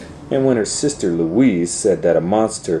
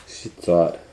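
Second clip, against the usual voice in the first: usual speaking voice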